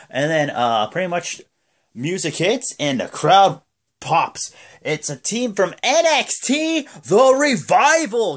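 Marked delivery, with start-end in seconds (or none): 4.8-8.4 high, slow, drawn-out reveal; announcer hype. Retold or imitated. Revealing the surprise team, hammed-up announcer call. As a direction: announce it like a big entrance.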